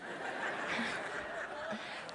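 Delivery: quiet airy chuckle; real rueful laugh at the punchline; soft breathy laugh. Real, not acted. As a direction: laugh under your breath at yourself. Audience-facing laugh after punchline.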